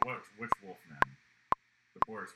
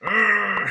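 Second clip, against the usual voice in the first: loud growling roar; monster roar; 7 semitones higher, 13 dB louder than usual. Retold or imitated. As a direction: roar like a beast. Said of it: "he's like".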